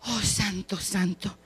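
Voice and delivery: breathless whisper of praise; soft prayerful whisper; whispered worship. Real, not acted. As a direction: hush it to a reverent whisper. Worshipful whisper after prophecy.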